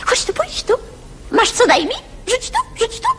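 high quail calls; quail calling. Acted. Little quail calling others over, high. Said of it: "Przepióreczka".

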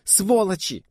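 high outraged yell; anguished cry. A narrator's reading. Liza curses the shooters, anguished high cry. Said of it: "выкрикнула Лиза. Голос её мучительно вибрировал".

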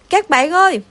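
narrator's moderate shout to the crowd; hollering. A narrator's reading. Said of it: "Hai thằng hét toáng". (hét toáng gọi các bạn)

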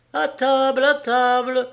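high, loud call to the table; crying out. A narrator's reading. Wife calling husband to eat, crying out. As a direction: call out excitedly. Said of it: "cria Séverine".

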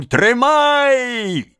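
high, long holler; frantic cry to grab him; wailing yell. A narrator's reading. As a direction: let out a drawn-out high yell. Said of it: "завертелся перед ним с воплем".

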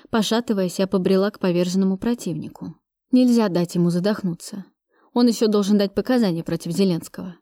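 usual speaking voice

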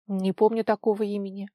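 usual speaking voice

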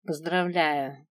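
slow, grudging congratulations; lukewarm, drawn-out mutter; unenthusiastic grumble. A narrator's reading. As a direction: grumble it with no enthusiasm. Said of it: "Без особого энтузиазма буркнула".

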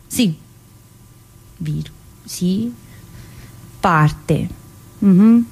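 soft unvoiced whisper. Inmate whispering before rereading the dictated line. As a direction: breathe the word to yourself.

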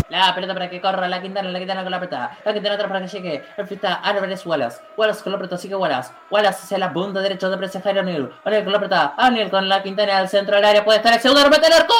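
rapid, building live call; fast play-by-play. Acted. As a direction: race the action, rising to the shot.

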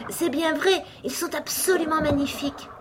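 high, delighted exclamation; sudden joy. A narrator's reading. Alice admiring the flowering rushes, sudden joy. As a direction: marvel aloud with sudden delight. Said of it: "dans un brusque transport de joie".

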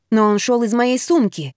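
plain reading voice

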